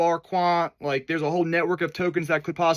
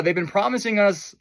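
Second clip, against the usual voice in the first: ordinary talk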